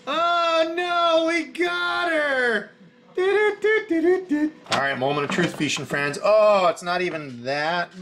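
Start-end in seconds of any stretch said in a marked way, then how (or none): 0.1-4.5 much higher, shouted in mock alarm; hammed-up "oh no", then hum a little tune; teasing cry, much higher; playful excited shout. Real, not acted.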